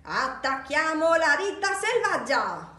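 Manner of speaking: high, loud rallying cry; wild yell. A narrator's reading. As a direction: rouse the monsters with a shout. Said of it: "urlò Max".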